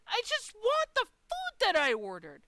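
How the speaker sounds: high, loud, drawn-out wail of frustration; exasperated outburst. Retold or imitated. Customer retelling her plea to Sonic staff, exasperated wail. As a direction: plead at full pitch, stretching the words.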